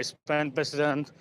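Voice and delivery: ordinary talk, nothing marked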